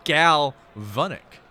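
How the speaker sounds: GALVANIC split slowly, GAL, then VON-ick; sounded-out pronunciation. Shown as a demonstration. Announcer relaying the chat's pronunciation, sounded out as gal-VON-ick. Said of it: "Cool Mold says".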